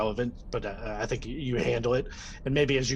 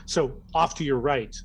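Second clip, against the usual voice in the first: ordinary delivery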